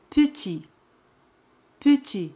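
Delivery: petit with no T at the end; silent final T. Shown as a demonstration. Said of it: "T al final es muda".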